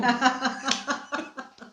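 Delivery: hearty cackling laugh; burst of laughter. Real, not acted. Doula reacts to host's irony, laughing hard.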